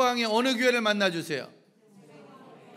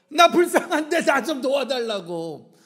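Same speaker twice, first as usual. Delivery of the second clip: loud, drawn-out mock plea; crying out; 3 semitones higher, 6 dB louder, slower than usual. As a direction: wail it out like a desperate beggar. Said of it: "소리를 지르는 거예요". (불쌍하게 소리 지르며 애원하는 흉내)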